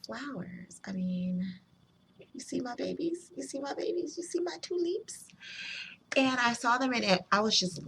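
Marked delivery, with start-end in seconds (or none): 2.3-5.3 put-on high, soft baby-talk cooing; doting coo. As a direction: show off your darlings in a soft high coo. Cooing over her flowers to camera.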